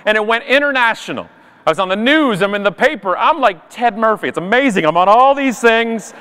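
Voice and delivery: ordinary delivery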